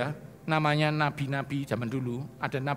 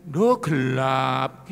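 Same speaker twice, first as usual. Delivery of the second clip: drawn-out whine; 5 dB louder, much slower than usual. Shown as a demonstration. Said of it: "dia tidak kelihatan gini".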